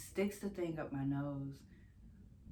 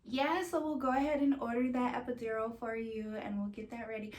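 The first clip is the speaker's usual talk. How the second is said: higher-pitched imitation of an obliging nurse; chipper nurse; 7 semitones higher than usual. Nurse offering another patient an epidural, mock obliging. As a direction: put on a sing-song hospital helper voice. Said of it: "another nurse talking to one of the other ladies".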